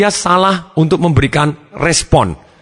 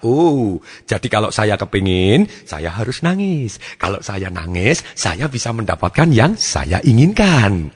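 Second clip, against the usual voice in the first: slow, lower thinking aloud; child's dawning realisation; 5 semitones lower, slower than usual. Acted. Child working out that crying gets rewards, slow realisation. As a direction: draw the words out as the lesson sinks in. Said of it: "dia menghubungkan antara satu perasaan".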